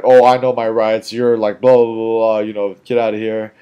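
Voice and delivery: loud, cocky brush-off; mock dismissive retort. Mocks how not to respond when confronted.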